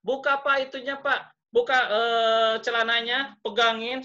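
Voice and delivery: lively imitation of giving instructions; acted-out instructions. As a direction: voice someone instructing a patient. Retold instructions to undress, acted.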